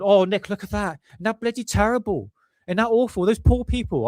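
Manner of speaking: high, fretting nan voice; imitating nan. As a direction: fuss like a worried grandmother. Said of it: "she's going".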